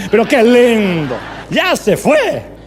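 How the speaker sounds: slow, loud mock-jubilant proclamation; Juan Carlos Ortiz. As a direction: draw out each word in exaggerated delight. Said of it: "dice Ortiz".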